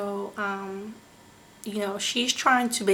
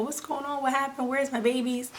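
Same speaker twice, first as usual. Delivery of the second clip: retold anxious questions; 4 semitones higher than usual. Retold confused questions on waking up.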